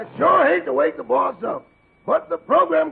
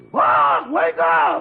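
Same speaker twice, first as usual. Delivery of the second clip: shouting; 12 semitones lower, much slower than usual. Acted. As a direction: bellow him awake. Shouting at boss.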